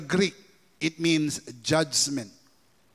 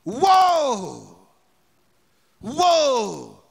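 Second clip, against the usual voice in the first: louder, higher cry of woe; 6 semitones higher, 8 dB louder, much slower than usual. Acted. Preacher reading the eagle's call to the earth, loud. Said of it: "call out in a loud voice".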